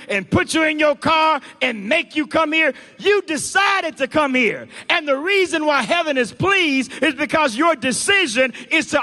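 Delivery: loud, driving sermon delivery; full-force preaching. Real, not acted. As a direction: preach it hard, building in pitch and force.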